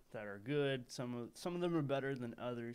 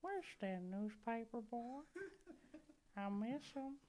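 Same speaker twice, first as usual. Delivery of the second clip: put-on old man from Family Guy; 9 semitones higher, 4 dB quieter than usual. Luring the paperboy to the basement, high old-man voice. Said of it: "old man from Family Guy".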